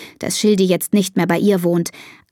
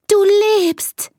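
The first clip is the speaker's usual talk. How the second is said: relieved cry of discovery; joyful exclamation; 13 semitones higher than usual. A narrator's reading. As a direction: exclaim it to the found pet. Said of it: "ruft Elisa".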